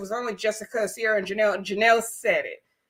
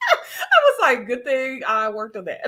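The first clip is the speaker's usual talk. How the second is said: laughing through the words; 2 semitones higher than usual. Real, not acted. Vlogger amused by an old habit now fixed, laughing.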